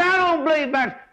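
high, disbelieving protest; a churchgoer's high-pitched disbelief; incredulous objection. Pretend. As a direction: scoff at the idea, voice jumping up. Imagined listener objects, disbelieving.

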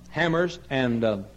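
ordinary delivery

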